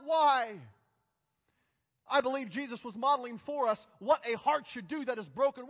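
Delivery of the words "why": loud pained why; cried out. Retold or imitated. Jesus on the cross asking the Father, a loud pained cry. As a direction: cry the question up to heaven. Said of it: "He cried out".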